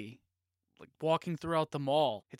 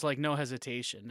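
ordinary delivery

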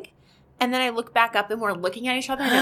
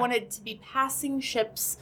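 usual speaking voice

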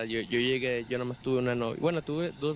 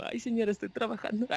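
high, laughing bashful protest; a flustered young seller embarrassed by the lady; 10 semitones higher than usual. Retold or imitated. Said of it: "así como". (protesta tímida entre risas, aguda)

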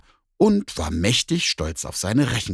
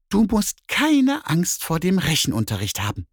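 much higher, coaxing cat voice; meowing cat; 9 semitones higher, 3 dB louder than usual. Acted. Cat reassures the bear about maths class, high and mewing. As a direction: mew it encouragingly. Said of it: "maunzte die Katze".

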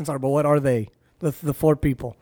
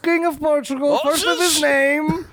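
town crier; 8 semitones higher than usual. Pretend. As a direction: proclaim the king's titles grandly. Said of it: "So we have the first king of Portugal".

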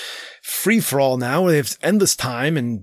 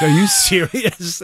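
drawn-out, higher disbelief breaking with a laugh; incredulous; 5 semitones higher, slower than usual. Retold or imitated. Dad hears about quarantined papers, laughing incredulity. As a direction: stretch the question in amused disbelief. Retold disbelief at son's news.

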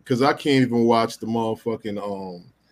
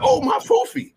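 very high, gushing cartoon lion. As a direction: squeal it like a happy cartoon lion.

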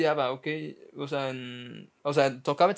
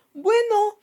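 mock high shrug; 9 dB louder than usual. Kid shrugging at friend's cover.

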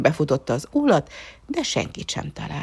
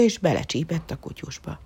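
plain reading voice

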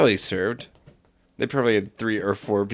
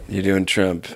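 ordinary delivery

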